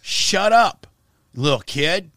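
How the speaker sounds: loud and high, barking it at her; retold yell. Taunting the teen activist on his radio show, loud yell. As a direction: shout her down on the air. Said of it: "And then I just kept saying".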